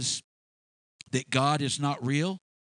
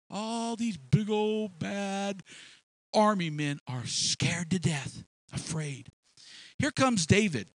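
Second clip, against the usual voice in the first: normal talking voice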